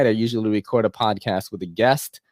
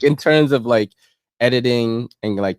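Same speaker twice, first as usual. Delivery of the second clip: ordinary delivery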